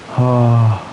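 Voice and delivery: ordinary delivery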